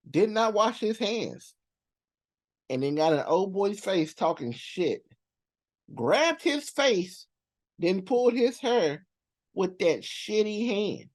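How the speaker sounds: slow, high, drawn-out disbelief; appalled emphasis. Real, not acted. Recounting a man who left the stall unwashed, slow appalled emphasis. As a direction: stretch each phrase high, spelling out the outrage. Disgusted, emphatic retelling of gross act.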